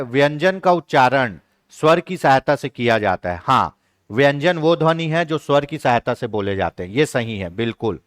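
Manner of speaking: ordinary talk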